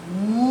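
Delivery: low, soft moo; soft lowing moo for kids; cow moo. Shown as a demonstration. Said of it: "let's try making the sound of the cow".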